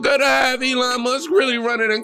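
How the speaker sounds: high whiny mock of a liberal, dramatic. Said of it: "the left's new rhetoric".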